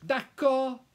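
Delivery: very high, loud French exclamation; sharp interruption. A narrator's reading. Housekeeper cutting off the professor, sharp. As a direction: cut in forcefully. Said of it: "the housekeeper cut him off".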